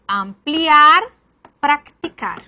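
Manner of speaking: "ampliar, practicar" broken into syllables; "ampliar, practicar" with a gap inside each word; syllable division. Shown as a demonstration. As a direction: say am-pliar, prac-ticar for "ampliar, practicar". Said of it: "la primera consonante se une".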